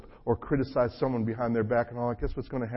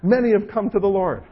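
normal talking voice

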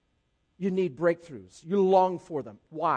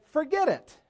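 higher, louder dismissive grumble; mock grumbling; 9 semitones higher, 5 dB louder than usual. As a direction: grumble it out, imitating a fed-up complainer. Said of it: "Abraham's grumbling to God".

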